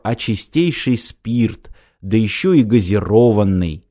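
plain reading voice

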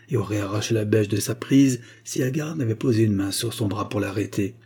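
ordinary delivery, nothing marked

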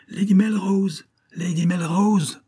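high, loud, drawn-out call; appel fort. A narrator's reading. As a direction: call through a door to rouse someone. Calling out to sleeping lady. (appel lancé d'une voix haute et forte)

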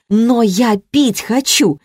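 mild exasperated yell, drawn out; breaking into a cry. A narrator's reading. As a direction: burst out, stretching the words. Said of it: "сорвавшись на крик".

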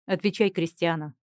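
stern low demand. A narrator's reading. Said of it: "строго спросил Элияр".

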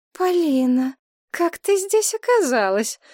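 high, slow astonished cry; astonished exclamation. A narrator's reading. Mother finding her old friend behind the curtain, astonished cry. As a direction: call her name in disbelief. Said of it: "воскликнула ... в недоумении".

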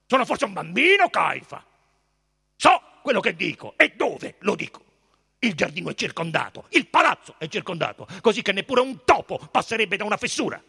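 loud, quick, heated retort; impatient Pilate. A narrator's reading. Pilate rebuffs Caifa's caution, loud and impatient. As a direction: fire it back, fast and forceful.

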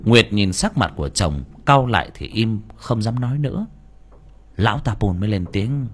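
plain reading voice

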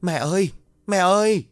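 narrated wail, drawn up high; tearful cry. A narrator's reading. Daughter calling for her beaten mother, high tearful cry. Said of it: "òa khóc rồi hét lớn".

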